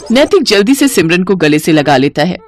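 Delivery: ordinary delivery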